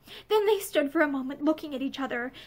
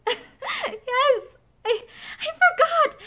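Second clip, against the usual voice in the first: much higher, tearful half-laugh; tremulous smile; 11 semitones higher, much slower than usual. A narrator's reading. Woman agrees to see him tomorrow, tremulous and smiling. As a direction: stammer through tears with a smile. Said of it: "smiling tremulously".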